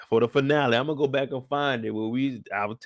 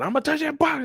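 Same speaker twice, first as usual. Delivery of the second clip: much higher defiant voice; hammed-up teen defiance; defiant son; 13 semitones higher than usual. Son defying his father over the box, much higher. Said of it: "They said".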